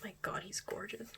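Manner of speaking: stunned little whisper; awed whisper. Real, not acted. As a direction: whisper it to yourself, starstruck. Gushing over idol photos.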